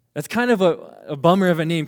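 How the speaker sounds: usual speaking voice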